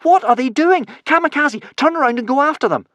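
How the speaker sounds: very high, loud desperate shouts; frantic high-pitched shouting. A narrator's reading. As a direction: yell after departing boats.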